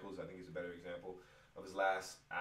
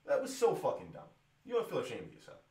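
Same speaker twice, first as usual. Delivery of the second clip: high, loud furious jab; angry jab; 7 semitones higher, 5 dB louder than usual. Real, not acted. Fan ranting at the announcer with a loud angry jab. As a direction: spit it at him.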